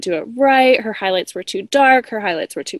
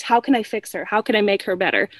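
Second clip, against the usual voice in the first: ordinary delivery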